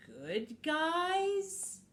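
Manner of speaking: high, rising little-creature question; skeptical guinea pig. Acted. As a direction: creep upward in disbelief. Guinea pig questions the good guys.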